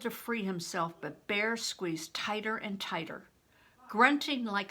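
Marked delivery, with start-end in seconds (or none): none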